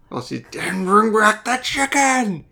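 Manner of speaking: slow, hammed-up angry imitation; mock villainous anger. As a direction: draw it out like an angry movie villainess. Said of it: "she sounds so angry".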